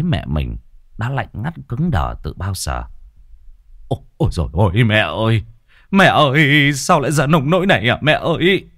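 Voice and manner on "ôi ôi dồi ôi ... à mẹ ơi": loud anguished wail, higher pitched; wailing for his mother. Acted. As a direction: cry out in shock over a mother's body. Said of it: "nghe loáng thoáng tiếng la hét của chồng".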